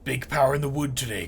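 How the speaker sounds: loud heroic announcement; booming declaration. Acted. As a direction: boom it out like a woodsman hero. Cutter character declaims.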